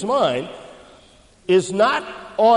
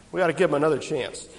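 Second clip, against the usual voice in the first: ordinary talk, nothing marked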